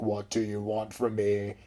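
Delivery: low, slow, soft imitated creature voice; creepy talking goat. Goat suddenly answers the girl, slow creepy mock voice. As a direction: draw it out as a creepy devil goat. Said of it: "but it sounded really creepy".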